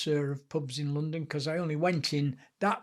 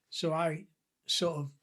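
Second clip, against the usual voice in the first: plain reading voice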